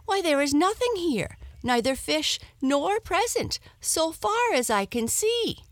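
high, loud, quick complaint; indignant wife. Acted. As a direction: scold him in disbelief. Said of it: "exclaimed his wife".

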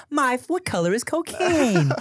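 put-on high, cute kids'-show host; Miss Rachel. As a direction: ask toddlers a question in a sweet high voice. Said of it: "super cute, pure voice".

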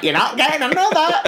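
high, quick mock scolding; imitated canteen telling-off; dinner lady's refusal. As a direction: fire it off like a cross dinner lady. Dinner lady snapping back.

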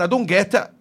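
ordinary talk, nothing marked

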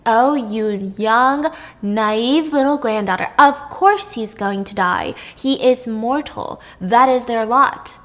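put-on slow, condescending old goddess; patient grandmother. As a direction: spell it out slowly to a naive child. Old grandmother lecturing naive girl.

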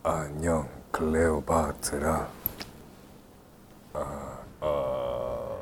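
low croaky burp-like voice. Acted. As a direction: push it out like a belch. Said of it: "You sound like you're burping".

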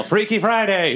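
high-pitched shout in passing; playful yell. Acted. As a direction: call it out high, for laughs. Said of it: "I just yell".

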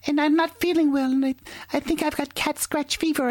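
high whiny wife voice. Retold or imitated. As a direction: squeak it like a sick wife. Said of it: "She goes".

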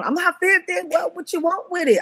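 high, hyped-up retold boast; mock hooping and hollering; gleeful hollering. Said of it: "I was hooping and hollering".

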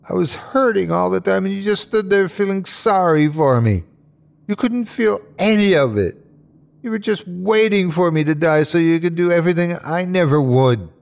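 high-pitched, venomous accusation; rabid vitriol. Acted. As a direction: let the hatred rise and spill out. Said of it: "each word growled and soaked with vitriol".

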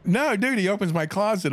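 high excited burst. Real, not acted. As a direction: blurt it out excitedly. Excited start of the story.

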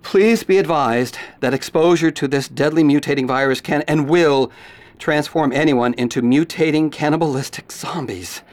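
low, grave anchor read; news report. Acted. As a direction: report the disaster soberly.

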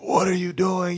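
imitating coach yelling at him to stop running off; mock coach. High squeaky coach voice asking what he's doing. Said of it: "and coach is like".

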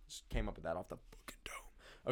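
a smug little whisper to yourself; hushed whispered brag; whispered aside. Real, not acted.

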